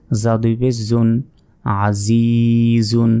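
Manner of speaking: Azizun chanted very slowly, vowel held long; drawn-out recitation. Shown as a demonstration. Teacher reciting Azizun slowly for students to copy. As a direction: stretch Azizun as in Quran recitation. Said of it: "এক second টেনে পড়তে হবে".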